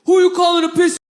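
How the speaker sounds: loud, high retold demand; angry challenge. Driver turning back with clenched fists, acted angry shout. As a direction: demand it as if ready to fight. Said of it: "his fists are clenched this time".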